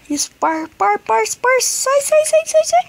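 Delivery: rising panicked high yelling; panicking gamer. Real, not acted. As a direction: shriek it as the fear builds. Mutant attacking in game.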